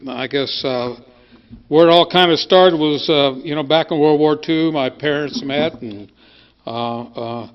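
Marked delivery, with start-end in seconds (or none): none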